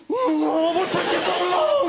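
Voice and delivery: high, laughing mock outrage at the bouncer; mock indignant fighter. Nightclub fighter mocking the bouncer, high laughing indignation. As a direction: squeal it high through laughter. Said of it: "this man was being like".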